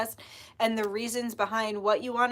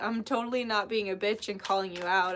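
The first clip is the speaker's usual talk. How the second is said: ordinary talk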